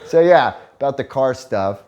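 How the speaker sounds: normal talking voice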